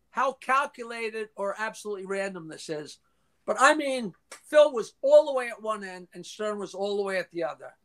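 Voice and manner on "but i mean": ordinary talk, nothing marked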